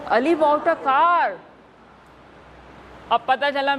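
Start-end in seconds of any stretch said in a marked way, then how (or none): 0.1-1.3 higher-pitched, excited announcement. Shown as a demonstration. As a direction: say it like thrilling news. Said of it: "बहुत excited होके बताऊंगी". (बहुत उत्साहित होकर)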